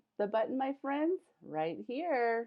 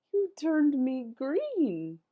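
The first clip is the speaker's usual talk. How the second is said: surprised; 4 semitones higher, 6 dB louder, slower than usual. Acted. Character surprised at turning green.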